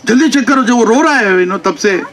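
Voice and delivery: high, insistent imitation of an old woman; urgent old mother. Old mother to doctor as Krishna is crying, high urgent nagging. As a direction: retell her hurrying him along.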